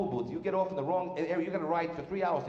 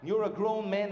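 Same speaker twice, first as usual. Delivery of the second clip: ordinary delivery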